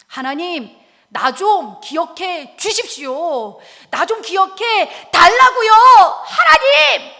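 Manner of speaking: loud plea building to a high shout; wailing prayer. Acted. Showing how to pray like weeping Hezekiah, wailing shout. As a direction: cry out to God with mounting desperation. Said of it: "엉엉 울면서 통곡하는 겁니다".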